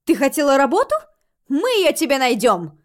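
high, loud and eager; excited exclamation. A narrator's reading. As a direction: promise it with loud enthusiasm. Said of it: "воскликнула она".

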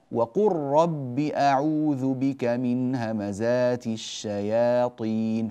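slow recitation, وقل رب as waqurrab; merged lam into ra. Shown as a demonstration. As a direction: glide straight from qaf to ra in وقل رب. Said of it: "قر من قاف إلى راء مباشرة".

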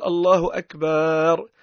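"أكبر" said as "أكبار" with a long a between ب and ر; "الله أكبر" pronounced "الله أكبار"; added alif after ba. Shown as a demonstration. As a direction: stretch "أكبر" into "أكبار", slowly. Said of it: "يحط ألف بينها وبين الراء".

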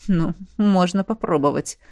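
a dry chuckle in the words; smirking swordsman. A narrator's reading. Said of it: "усмехнулся мечник". (с усмешкой, голос мечника)

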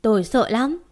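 usual speaking voice

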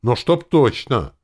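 loud, high demand, drawn out; insistent. A narrator's reading. Volodya slapping the table for confirmation, insistent. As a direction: press for a firm promise, loudly.

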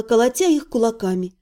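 usual speaking voice

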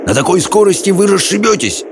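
urgent high-pitched warning; barking. A narrator's reading. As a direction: bark out the warning. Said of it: "рявкнул Хоул". (тревожный окрик на высоких тонах)

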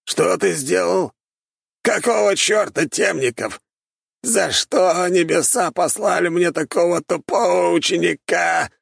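put-on high, slow, rasping old man's rage; wheezing angrily. A narrator's reading. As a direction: wheeze out the outrage. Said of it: "захрипел он".

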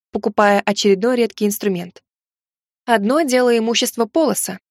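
plain reading voice

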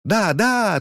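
high, squeaky eager cry; eager fairy exclamation. A narrator's reading. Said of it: "тут же воскликнули феи".

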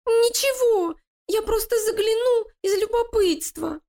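very high, squeaky voice; frightened rat. Acted. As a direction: excuse yourself like a scared rat. Испуганная крыса оправдывается. (испуганная крыса)

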